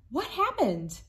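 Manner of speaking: ordinary delivery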